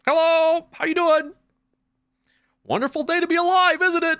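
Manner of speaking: piercingly high, loud cheery greeting; hammed-up chirpy early riser; mock morning person. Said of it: "It's like in the morning, they're like".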